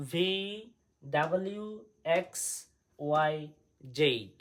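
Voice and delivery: letter by letter, pause after each; loud, distinct drill for pupils to repeat; alphabet model reading. Shown as a demonstration. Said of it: "আমি আরেকবার পড়ে দিচ্ছি". (একটা একটা করে স্পষ্ট অক্ষর পড়া)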